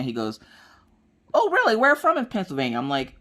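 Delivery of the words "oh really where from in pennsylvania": surprised. Retold or imitated. Guy learns of the Pennsylvania daughter.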